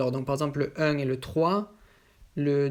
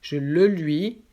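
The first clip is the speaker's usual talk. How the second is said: "Je le lui" louder, set against the wrong one; correct form stressed; 5 dB louder, slower than usual. Shown as a demonstration. Teacher giving the correct order emphatically. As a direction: land "le lui" firmly. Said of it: "correct form".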